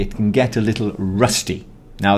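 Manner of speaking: ordinary talk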